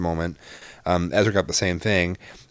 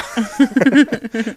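high laugh; 13 semitones higher, 4 dB louder than usual. Real, not acted. Laughing at own bit.

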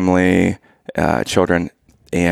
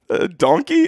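put-on hesitant kid; 10 semitones higher than usual. Kid guesses unsure in retold joke.

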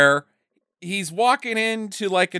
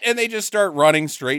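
ordinary delivery

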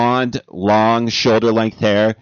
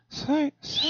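very high falsetto voice; 9 dB quieter than usual. Pretend. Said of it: "Trying to find the range".

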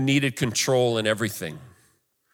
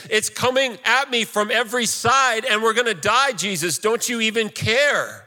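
pleading outcry climbing from near-normal to very high pitch; acted shouting; 9 semitones higher than usual. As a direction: build into a desperate, high-pitched protest, acting the disciples. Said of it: "The disciples woke him up shouting".

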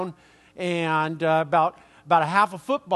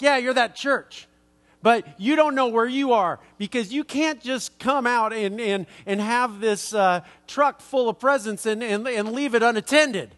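aggressive confrontation; 4 semitones higher than usual. Retold or imitated. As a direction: get in his face and demand it. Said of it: "real aggressively".

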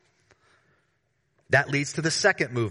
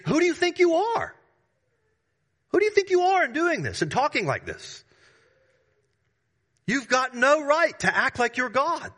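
much higher, indignant rhetorical question; incredulous challenge; 12 semitones higher than usual. Acted. Preacher voicing James's challenge, indignant and high. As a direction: challenge them like an offended judge.

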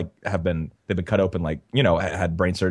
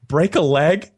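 higher, louder, laughing guess; retold through laughter; 8 semitones higher, 6 dB louder, much slower than usual. Son quoting dad decoding mom's phrase, laughing retelling. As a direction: quote the correction while cracking up.

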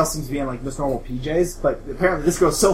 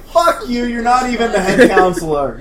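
annoyed retort; 7 semitones higher, 7 dB louder than usual. Acted. Camper snaps at girl.

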